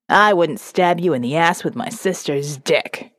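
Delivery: a hint of Jeb's menace. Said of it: "my little notebook of Jeb-isms".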